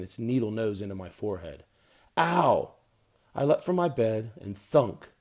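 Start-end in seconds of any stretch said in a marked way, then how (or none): 2.2-2.7 mock pain yelp. Mosquito bite.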